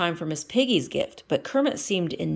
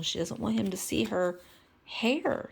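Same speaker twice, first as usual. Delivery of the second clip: plain reading voice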